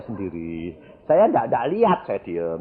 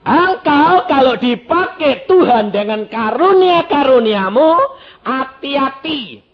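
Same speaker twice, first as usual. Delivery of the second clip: loud, high, hammering sermon delivery; full-force preaching; 12 dB louder, slower than usual. Real, not acted. Preacher explains John's witness to the congregation, full-force preaching. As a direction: preach at the top of your force, pitched high.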